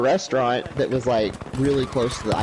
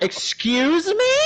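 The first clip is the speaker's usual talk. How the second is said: high, slow, stunned question; shocked disbelief; much slower than usual. Retold or imitated. Guest stunned by the manager's slur, high slow disbelief. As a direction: drag it out in disbelief.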